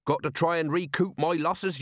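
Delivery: high-pitched self-justifying excuse; flustered bluffing. A narrator's reading. Merchant justifies himself to the commander, flustered. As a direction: keep talking to cover the lie, pitch up.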